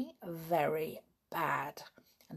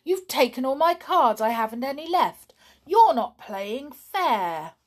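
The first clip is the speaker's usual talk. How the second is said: upset complaint; 7 semitones higher, 8 dB louder than usual. Acted. Donald upset losing his cards.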